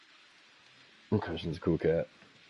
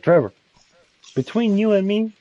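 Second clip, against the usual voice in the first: loud mock confiding; 11 semitones higher, 5 dB louder than usual. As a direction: announce a secret loudly. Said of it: "like, out loud, instead of being, like, undertone".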